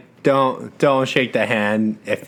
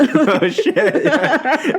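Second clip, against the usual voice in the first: surprised laugh; 5 dB louder, much slower than usual. Real, not acted. Caught out, laughing.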